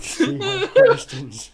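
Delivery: high, slow mocking echo. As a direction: echo her in a high squeaky voice.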